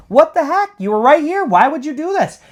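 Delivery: very high, loud exasperated call. Retold or imitated. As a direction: act out calling to a missing friend, indignant. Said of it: "Carlos is calling out to him".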